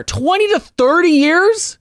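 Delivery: slow, high, rising disbelief; drawn-out incredulous echo; incredulous question. Real, not acted. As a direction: repeat it high and stretched out, astonished. Repeats the figure in disbelief.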